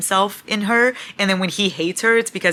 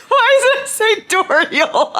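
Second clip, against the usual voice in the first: high, loud words through laughter; cracking up at a silly misreading; slow, squealing laugh-talk; 13 semitones higher, 5 dB louder, slower than usual. Real, not acted.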